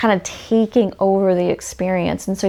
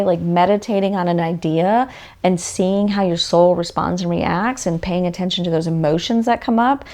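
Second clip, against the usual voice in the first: usual speaking voice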